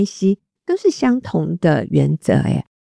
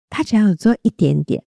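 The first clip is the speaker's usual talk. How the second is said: ordinary delivery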